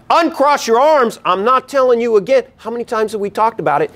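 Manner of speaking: stressed-out demand; harsh scolding. Shown as a demonstration. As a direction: bark it like a fed-up parent. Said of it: "So when it's like".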